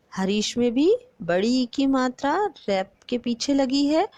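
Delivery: ordinary talk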